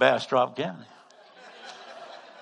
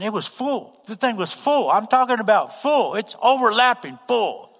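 "full" pitched high and hit harder each time; emphatic "full"; 8 semitones higher, 6 dB louder than usual. Real, not acted. Preacher describing an overloaded basket, building emphasis on "full". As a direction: build loudness on each "full", stretching the last.

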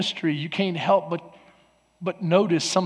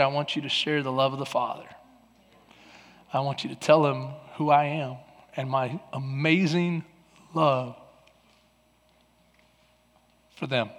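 gentle voice of God; 2 semitones lower than usual. Retold or imitated. Pastor voicing God's message to him, gentle and tender. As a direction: speak it like a loving father. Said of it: "he just gently said".

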